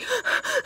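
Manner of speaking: sharp high gasp of panic; panicked gasp. Retold or imitated. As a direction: suck in air like you're about to faint. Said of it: "freaking out".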